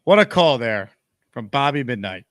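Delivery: normal talking voice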